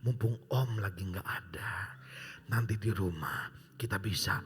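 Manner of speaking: low breathy whisper of a tempting aunt; seductive whisper. Retold or imitated. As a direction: lure him home in a hushed whisper. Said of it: "dia ngomong gini".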